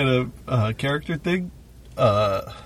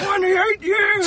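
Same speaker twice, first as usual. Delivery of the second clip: put-on much higher, slowed, shouting old-man voice; snapping old man; 4 dB louder, much slower than usual. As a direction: snap at her in exasperation. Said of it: "snapped at the old lady".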